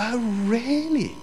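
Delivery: high, drawn-out imitation of astonishment; mock surprise. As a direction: stretch it out in exaggerated disbelief. Pastor reacts to gossip, acted.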